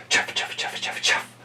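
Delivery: soft breathy chuffs; train chuffing. Acted. The train leaves town, soft puffing chuffs. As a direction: puff like a steam engine.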